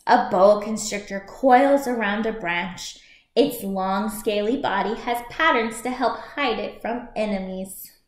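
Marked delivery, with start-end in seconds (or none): none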